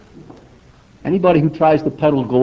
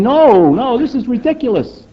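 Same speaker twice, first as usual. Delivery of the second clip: scientists scoffing; 8 semitones higher than usual. Retold or imitated. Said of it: "speaking out and saying".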